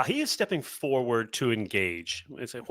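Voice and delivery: ordinary talk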